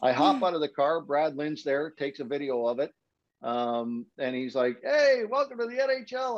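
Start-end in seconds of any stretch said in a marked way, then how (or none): 4.8-6.4 cheery greeting. Retold or imitated. Colleague greeting him, quoted.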